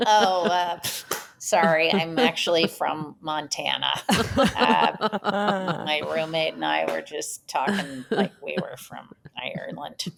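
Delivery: put-on sheepish drop out of the accent; Chris Fairbanks. Roommate caught faking Irish by the neighbor, sheepish mumble. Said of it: "And Chris is like".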